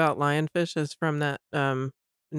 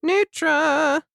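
loud high call across the room; calling the dog by name; 10 semitones higher, 5 dB louder than usual. Real, not acted. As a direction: shout the name to fetch her.

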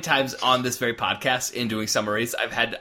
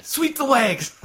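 sensei order; 12 semitones higher, slower than usual. Retold or imitated. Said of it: "because of the line".

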